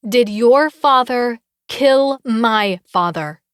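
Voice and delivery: DID YOUR FATHER KILL MY FATHER, every word slow, loud and raging; each word emphasized. A narrator's reading. Enraged daughter confronting her rival, "Did your father kill my father" punched word by word. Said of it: "She emphasizes each word, her eyes blazing with rage".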